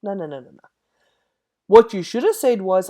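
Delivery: ordinary delivery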